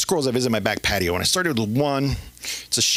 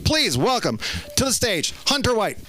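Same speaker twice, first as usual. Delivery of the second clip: raised-pitch MC announcement; stage introduction; 7 semitones higher than usual. Real, not acted. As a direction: announce the next comic to the crowd. Host calls next comic onstage.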